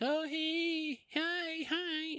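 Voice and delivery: very high, soft coaxing voice to a dog; high baby talk to call the dog; sweet talking the dog. Retold or imitated. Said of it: "You know, I'm like".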